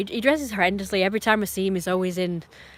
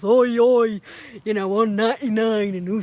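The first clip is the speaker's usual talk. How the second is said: a hint of a loud northern lad, shouting oi; lightly put-on scally voice; mock northern scally; 5 dB louder than usual. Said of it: "scally type person".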